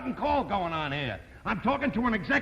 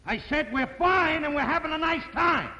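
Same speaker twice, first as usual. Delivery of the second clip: louder for the phone; 7 semitones higher than usual. Acted. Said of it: "could you talk a little louder".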